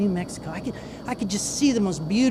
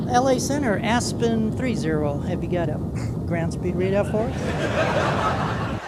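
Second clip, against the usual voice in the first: put-on innocence, slow and unhurried on the radio; innocent voice; slower than usual. Walter in the back seat asking Center for a speed, mock innocent. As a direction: ask it ever so innocently, drawing out the question. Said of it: "In his best innocent voice".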